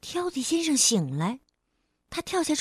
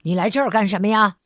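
rapid irritated question; 3 semitones lower, 7 dB louder, much faster than usual. Acted. Fussy demanding why he came.

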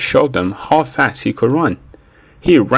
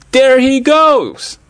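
high, drawn-out excited yell; high-pitched story-time holler; 13 semitones higher, 4 dB louder, slower than usual. A narrator's reading. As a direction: yell it high and slow, pointing out the runaway. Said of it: "Tess yelled".

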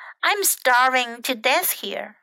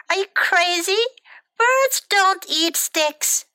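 high, indignant storybook bird; acted exasperation, pitched up; 8 semitones higher, 3 dB louder than usual. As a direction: protest as if insulted by the offer. Bird balks at eating sticks.

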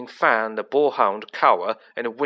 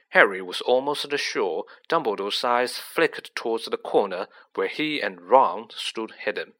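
ordinary delivery

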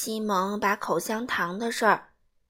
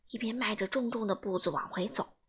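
ordinary delivery

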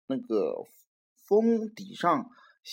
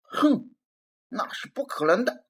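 huffy reply; 7 semitones higher than usual. Acted. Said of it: "一边气呼呼地回答说".

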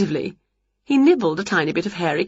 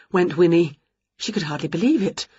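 ordinary delivery

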